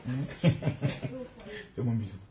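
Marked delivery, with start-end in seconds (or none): 0.6-1.7 high, soft chuckle; soft laugh. Real, not acted. Father coaxing his upset daughter, soft laugh. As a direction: chuckle lightly, high and quiet. Father teasing sulking child.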